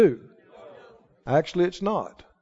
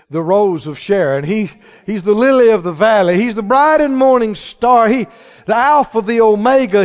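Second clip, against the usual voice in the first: loud, raised-pitch roll call of praise; exultant preaching; 5 dB louder than usual. Real, not acted. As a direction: proclaim each title with mounting fervor. Preacher building praise of Jesus.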